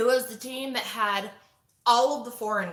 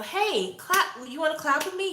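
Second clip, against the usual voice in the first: light, high, asking tone; "clap" said high and rising, like a question; limp request; 8 semitones higher, 5 dB louder than usual. Shown as a demonstration. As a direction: ask it like a timid favour. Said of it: "you don't wanna sound like".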